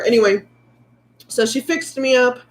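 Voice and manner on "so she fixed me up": ordinary delivery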